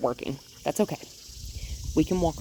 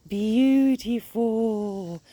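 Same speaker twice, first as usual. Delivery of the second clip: very slow, higher drawn praise; 6 semitones higher, 4 dB louder, much slower than usual. Real, not acted. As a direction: savor the word. Praising dog sit.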